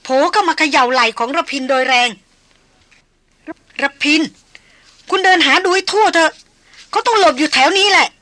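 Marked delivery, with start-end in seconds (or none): 2.9-8.1 frantic high shouting, building to long screamed calls; ringing shriek. A narrator's reading. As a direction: shout desperately, as if out of her mind. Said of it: "ร้องดังขึ้นในลักษณะกรีดก้อง".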